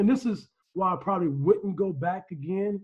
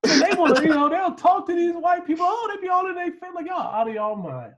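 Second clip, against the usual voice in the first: heated rant; 8 semitones higher than usual. Real, not acted. Ranting about the family.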